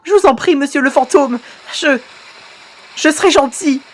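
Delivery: high-pitched acted pleading; frightened begging. Victim begging mister ghost for mercy, high frightened pleading.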